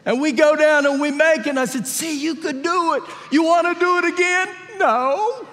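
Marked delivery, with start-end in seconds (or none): 1.9-4.5 put-on high-pitched cheering-on of a child; encouraging dad. Dad to his daughter after the ride, mock-excited encouragement. 4.8-5.5 put-on high, childlike no; imitated little girl. Daughter refusing dad's offer to ride again, high child voice.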